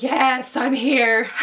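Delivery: high, loud goofy proclamation; playful mock-regal "yes, I'm here"; goofy king voice. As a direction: ham up a silly proud king. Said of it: "He seems so goofy. He's just like".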